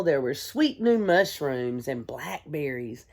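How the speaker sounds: ordinary talk, nothing marked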